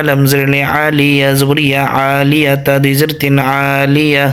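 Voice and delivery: ordinary talk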